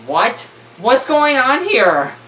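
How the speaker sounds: loud, high, incredulous exclamation; baffled outcry. Real, not acted. Reader balks at a confusing line in the story, loudly baffled. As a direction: blurt it out loudly, rising in disbelief.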